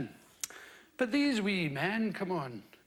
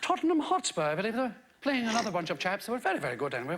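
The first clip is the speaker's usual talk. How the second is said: put-on English accent; 5 semitones lower, much faster than usual. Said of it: "Pretty good English accent".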